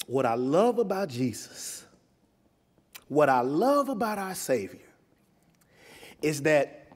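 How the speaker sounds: usual speaking voice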